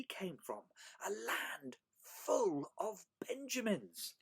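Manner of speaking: slow, high, wondering storytelling voice; animated picture-book reading; story read to children. A narrator's reading. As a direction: stretch out the marvel for young listeners.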